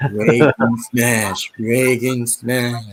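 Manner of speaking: slow zombie voice; Ronald Reagan. Retold or imitated. Cartoon zombie president smashing a wall, slow zombie voice. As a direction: drag each word out like a zombie. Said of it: "he was like a zombie".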